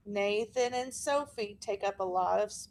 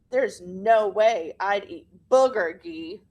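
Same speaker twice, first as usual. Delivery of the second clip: scornful refusal; 3 semitones lower, 7 dB louder, slower than usual. Acted. Kid mocks new boy's food.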